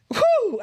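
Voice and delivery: acted-out high whoop of relief and awe; high whew of amazement. Guest retells spotting Issa Rae at the airport, amazed whew.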